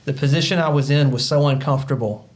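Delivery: normal talking voice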